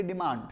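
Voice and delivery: डिमांड with the final d barely touched; soft final d. Shown as a demonstration. Teacher models डिमांड for students, end d soft. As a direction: say डिमांड softly, the last d almost dropped. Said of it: "ये बहुत ही softly pronounce करेंगे".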